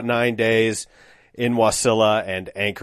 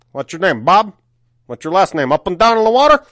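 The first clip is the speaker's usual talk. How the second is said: loud put-on voice climbing much higher; hammed-up character impression, rising and getting louder; Tony Clifton; 8 semitones higher, 6 dB louder than usual. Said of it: "That's how Tony Clifton talked".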